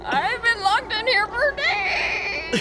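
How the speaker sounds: hammed-up woeful captive; Doctor Brewer voice. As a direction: moan it out melodramatically. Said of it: "Doctor Brewer said".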